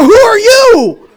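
very high, slow, drawn-out yell; panicked shout back. Retold or imitated. Husband throws the question back at the stranger, panicked shout. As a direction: wail it back in mock horror. Retold climax, husband echoes demand.

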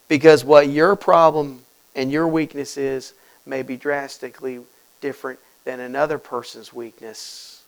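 ordinary talk

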